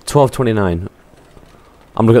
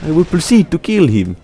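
hammed-up Russian with swooping higher pitch; mock Russian accent; 3 semitones higher than usual. Gamer narrating his play as a Soviet fighter, mock Russian accent. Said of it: "I shall talk Russian".

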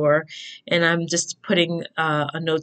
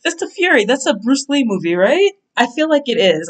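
excited; 6 semitones higher, 5 dB louder than usual. Real, not acted. Excited recall of movie.

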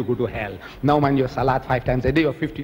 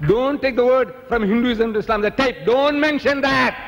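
high, emphatic re-enacted order, stretched at the end; mock inward shout; 7 semitones higher than usual. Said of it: "No, I didn't shout".

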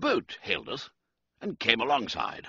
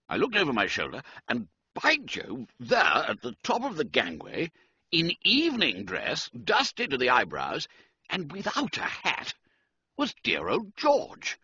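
plain reading voice

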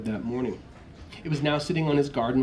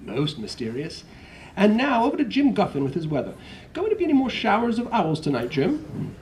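grinning newscaster; 10 semitones higher than usual. Acted. Newscaster handing over to Jim, high and chirpy. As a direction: hand over to the weatherman with a grin. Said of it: "The newscaster allowed himself a grin".

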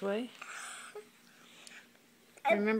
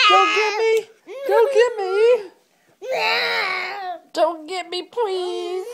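high, loud hammed-up pleading; mock fear; 11 semitones higher, 13 dB louder, slower than usual. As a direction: pretend to be scared of the monster. Mother playfully fleeing kitty monster.

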